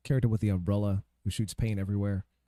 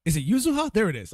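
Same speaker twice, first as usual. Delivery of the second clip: sudden realisation; 10 semitones higher, 6 dB louder than usual. Real, not acted. Suddenly recalls the name.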